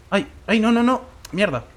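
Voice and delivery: high panicked cries. Real, not acted. Panicking as the fight turns against him. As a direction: panic as it goes wrong. Things going wrong.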